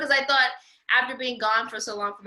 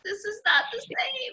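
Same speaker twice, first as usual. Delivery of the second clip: much higher, a half-laughing wail of letdown; dismayed cry; 13 semitones higher than usual. Retold or imitated. Finding the church changed, a high shouted wail. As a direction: cry it out in mock despair. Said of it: "I'm like".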